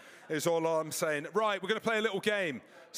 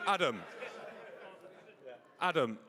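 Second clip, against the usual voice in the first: normal talking voice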